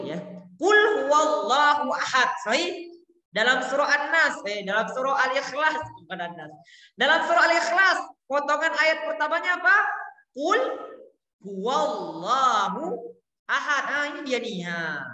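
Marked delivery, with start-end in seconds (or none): 0.6-2.3 Quran recitation. Shown as a demonstration. Quotes Surah Al-Ikhlas. 10.3-13.8 Quran recitation. Shown as a demonstration. Recites the verse slowly.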